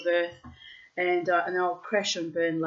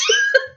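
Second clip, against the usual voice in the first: high bright laugh bursting out; burst of laughter; 9 dB louder than usual. Real, not acted. Telling the hug story, bursting into high laughter. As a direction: laugh out loud at the memory.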